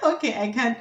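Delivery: ordinary talk, nothing marked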